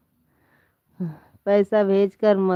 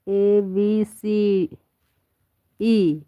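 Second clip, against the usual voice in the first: slow letter recital; much slower than usual. Shown as a demonstration. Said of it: "मुझे English नहीं आती है".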